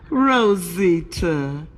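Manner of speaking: very slow, stretched-out name; Pedro coaxing. Acted. Pedro asks Rosita again, drawn-out coaxing. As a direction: call her name syllable by syllable.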